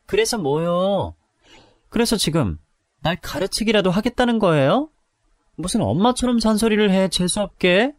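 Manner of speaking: mock her with scorn; contemptuous. Drunk sister-in-law picking fight. (비꼬는 말투)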